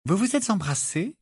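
high-pitched, eager question. A narrator's reading. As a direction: pounce on the question. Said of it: "demanda vivement Hermione". (question vive, voix aiguë)

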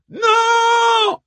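long, high shouted refusal; crowd yell. A narrator's reading. The women on the ramparts answer the speaker, yelling. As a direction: roar it back like a thousand women. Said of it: "hurlèrent le millier de femmes".

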